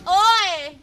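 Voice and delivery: high, loud Australian "Oi!"; mimicked Australian accent. Player mimicking a game character, shouted Australian accent. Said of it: "when you say oi in that accent".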